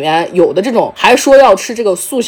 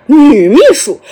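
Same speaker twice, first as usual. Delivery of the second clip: startled mock echo of the words; retold audience query; 3 semitones higher, 4 dB louder, slower than usual. Audience catching the female secretary, surprised echo. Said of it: "台下就".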